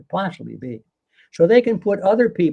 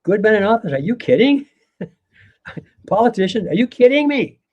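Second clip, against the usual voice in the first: mock disbelief, pitch rising higher and louder to the end; incredulous scoff; 6 semitones higher, 5 dB louder than usual. Retelling Jefferson's answer in modern words, loud incredulous scoff. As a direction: act out an exasperated are-you-kidding-me. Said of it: "In other words, he's saying".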